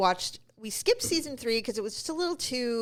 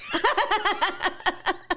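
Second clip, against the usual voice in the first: high burst of laughter; cracking up hard; big laugh; 9 semitones higher than usual. Real, not acted. Laughing at aunt's reply.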